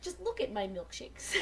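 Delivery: usual speaking voice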